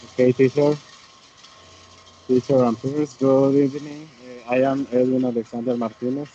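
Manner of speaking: ordinary talk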